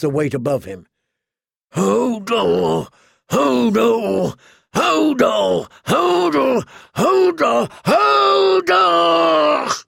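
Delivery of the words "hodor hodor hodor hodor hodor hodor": effortful cries climbing to a very high, long scream; straining cries. A narrator's reading. Hodor straining against the weight above him, building cries. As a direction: heave harder on each shout, pitch rising. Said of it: "as he strained".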